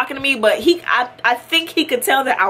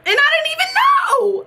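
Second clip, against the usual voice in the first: very high, exclaimed disbelief; incredulous. Retold or imitated. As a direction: wail it, amazed at your own blunder. Exclaims at missed celebrity.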